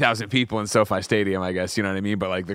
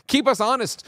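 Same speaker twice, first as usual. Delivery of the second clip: punchy command; 5 dB louder than usual. Acted. Host command to chat.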